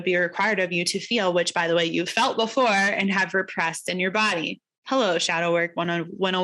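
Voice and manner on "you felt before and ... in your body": normal talking voice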